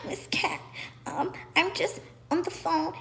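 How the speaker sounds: hushed breathy mock of the older woman; mocking imitation, near whisper; mocking her voice. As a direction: imitate her fake-sweet brush-off, hushed. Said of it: "when she started to try to mock me".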